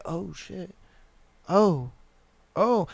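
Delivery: hushed "oh shit"; stunned. Retold or imitated. Imitating her deer in headlights look, stunned and hushed. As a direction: softly mimic a dazed realization.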